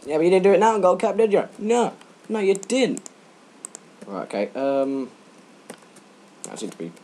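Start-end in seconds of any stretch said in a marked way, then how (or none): 0.1-3.1 loud, fast, higher taunt; teasing. Pretend. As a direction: rib someone with a smug jab. Mock teasing exchange.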